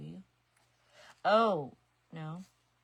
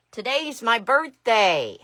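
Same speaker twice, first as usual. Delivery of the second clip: loud and slow, savouring it; excited announcement; 6 semitones higher, 20 dB louder, slower than usual. Real, not acted. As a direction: proclaim it loudly, stretched out. Announcing her birthday to viewers.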